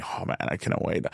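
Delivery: a soft unvoiced whisper; whispered bragging to his friend; whispered excitement. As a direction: hush it like a thrilled secret.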